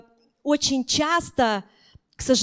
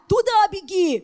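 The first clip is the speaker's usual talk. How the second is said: high mock yell; imitated shouting parents; 6 semitones higher, 4 dB louder than usual. Parents yelling at running children, high imitated shout. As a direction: holler like parents on the sideline. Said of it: "родители им кричат".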